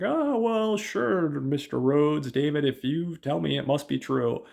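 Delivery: high, eager pushover voice, slow on the name; imitating a guard who gives in to David; nervous security guard. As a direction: play the guard caving in, high and loud. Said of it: "but he's like".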